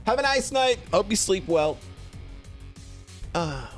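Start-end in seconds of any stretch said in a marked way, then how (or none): none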